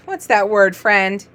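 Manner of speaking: ordinary delivery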